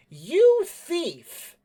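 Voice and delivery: loud, high indignant cry; high-pitched outrage; outraged accusation. Acted. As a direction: shout the accusation, high and drawn out. Stomps foot, accuses the man.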